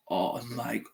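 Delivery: very soft, low and stunned; tearfully overwhelmed. Retold or imitated. Retelling the moment the package was opened, quietly overwhelmed. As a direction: murmur it in hushed disbelief. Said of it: "the tears were ready to roll".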